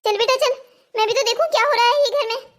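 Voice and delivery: put-on an old granny startled, then ready to march in; alarmed grandmother.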